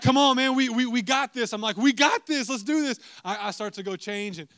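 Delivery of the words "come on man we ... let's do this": high, loud pumped-up chant; egging each other on; two friends hyping a storm chase; hyped up. Retold or imitated.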